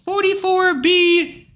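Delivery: high, slow sneering quote of forty-four B; mock a hater citing forty-four B. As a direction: drag it out like a know-it-all troll. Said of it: "and they quote".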